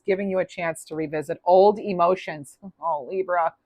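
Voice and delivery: ordinary talk, nothing marked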